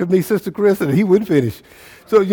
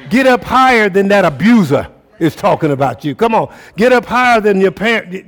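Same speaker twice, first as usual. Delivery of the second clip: higher and louder, building the call; full-force preaching; 7 semitones higher, 5 dB louder than usual. Acted. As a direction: lift them up from the pulpit, voice soaring.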